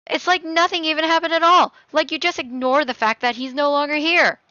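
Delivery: loud, high-pitched angry outburst. Acted. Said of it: "Do not raise your voice at me".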